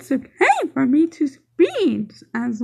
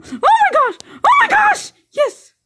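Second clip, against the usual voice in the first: excited yell; 4 dB louder than usual. Real, not acted. Game excitement.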